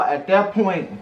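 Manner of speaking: ordinary talk, nothing marked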